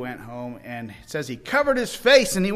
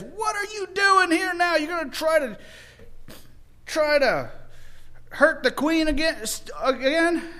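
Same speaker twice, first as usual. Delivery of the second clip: high, loud outraged demand; angry king; 11 semitones higher, 3 dB louder than usual. Retold or imitated. The king finding Haman at the queen's couch, high angry outburst. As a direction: catch him in the act and rage.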